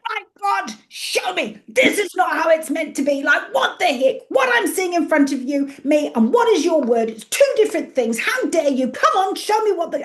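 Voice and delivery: high, loud, exasperated tirade; cross rant at God. Retold or imitated. Praying in her office, loudly venting frustration at God. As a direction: hurl frustrated demands upward, voice pitched high and loud. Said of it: "and I get so cross".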